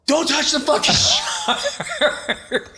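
high, long-drawn frantic yell; imitated panicked shouting; freaking out. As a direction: scream the warning, stretched out and breaking into laughter. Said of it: "you know, freaking out".

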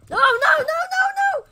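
very high, very loud shriek; panicked scream. Real, not acted. Player sees creeper being freed, panicked scream. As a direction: scream it in rapid repeated panic.

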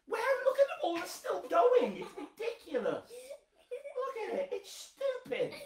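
high laughing exasperation; laughing disbelief. Acted. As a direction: squeal it through laughter.

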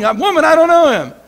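half-hearted yell, Peter shouting he doesn't know him; mock yell. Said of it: "he's yelling".

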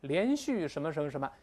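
ordinary talk, nothing marked

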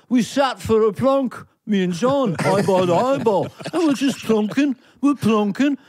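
high, singsong Liverpool lilt; hammed-up Scouse musician reminiscing. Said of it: "in his vernacular ever".

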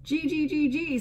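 high, bright bird voice; chirpy chickadee. A narrator's reading. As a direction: chirp it happily, inviting everyone. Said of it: "said the chickadee-dee-dee".

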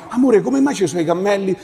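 ordinary delivery

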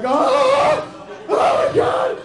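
very high, loud comic shriek; panicked scream. Retold or imitated. Man surrounded by oiled dudes, acted panicked scream. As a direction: scream in hammed-up horror, holding the first word long.

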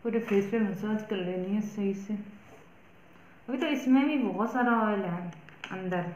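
talking with face covered; speaking through stiff mask; wearing sheet face mask. Real, not acted. Seen 2: Wet sheet mask covers whole face throughout; not in reference frames.

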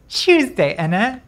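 loud, high mock British accent; bad British accent. Shown as a demonstration. Streamer retrying the accent, loud mock British. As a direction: belt out a cartoonish British accent. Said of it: "Am I best British accent".

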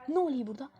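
normal talking voice

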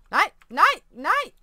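real alarm, high and loud; panicked shout. Real, not acted. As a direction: yell no at the screen. Player panics at game event.

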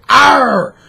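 loud high growling arr; mock snarl. Picturing a boxer biting an arm, loud mock roar. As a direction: bark it out like a biting maniac. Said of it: "being like".

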